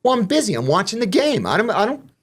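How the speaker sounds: high, put-upon excuse; mock protest. Inmate glued to the game beside a fight, mock protest. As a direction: plead it high, too busy to care. Retelling his indifference to a fight.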